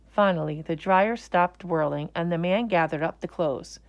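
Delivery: plain reading voice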